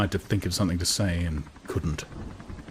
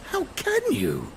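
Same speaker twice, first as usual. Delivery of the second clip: very high, aghast question; a shocked woman rebukes him; amazed distress. A narrator's reading. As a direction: gasp it out, scandalised. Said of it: "amazed distress".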